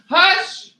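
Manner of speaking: high, loud Hush! thrown out; sharp hush. Real, not acted. Cutting off her barking pups with a hush.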